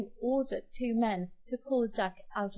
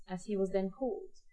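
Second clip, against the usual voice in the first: usual speaking voice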